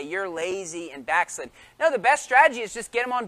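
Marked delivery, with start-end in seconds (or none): 0.0-1.5 low, slow mock scolding; understated rude reproach, dropped low; rude rebuke. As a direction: imitate a blunt put-down, softly and slowly. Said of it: "rude or bastos to them".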